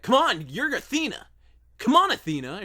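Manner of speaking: very high, loud gym hype shout; hype coach voice. Shown as a demonstration. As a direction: fire them up full force.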